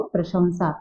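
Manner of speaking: प्रशंसा with a nasal anusvara, "pra-shum-saa"; teacher's answer, प्रशंसा as "prashum-saa"; correct pronunciation. Shown as a demonstration. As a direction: model प्रशंसा without a hard n. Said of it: "क्या बोलेंगे इसको".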